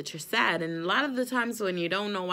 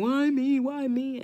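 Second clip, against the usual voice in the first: a higher, louder whine of why me; whiny self-pity; 6 semitones higher, 6 dB louder than usual. Retold or imitated. Her teenage self wallowing in depression, whiny self-pity. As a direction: wail it like a moping teenager.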